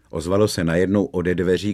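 ordinary delivery

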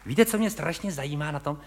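normal talking voice

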